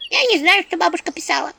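high embarrassed imp voice. Acted. Imp Anchutka embarrassed she doesn't know. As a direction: admit it sheepishly. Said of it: "тут же смутилась Анчутка".

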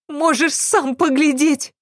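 high-pitched, irritated maid voice; curt maid interrupting. A narrator's reading. Maid cutting off the doubting servant, high irritated voice. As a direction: cut him off in a high voice. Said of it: "оборвала его Катрина".

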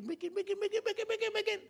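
high-pitched rapid-fire chant; frantic repetition. Acted. Preacher acts out endless slide making, frantic repetition. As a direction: rattle it off high like a machine.

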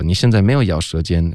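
ordinary delivery

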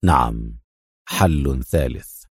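deep, slow confirmation; firm. A narrator's reading. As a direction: confirm it low, slow and resolute. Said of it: "يقول في حزم".

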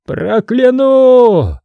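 high, slow, straining scream; straining shout. A narrator's reading. Ivan threatens to curse, straining slow scream. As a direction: tear your throat on it. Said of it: "надрывался Иван".

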